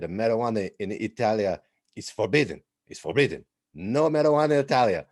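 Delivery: mock Italian police chief, broken English; Italian police chief lecturing the dad in a light Italian accent. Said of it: "he said".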